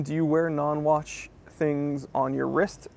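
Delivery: normal talking voice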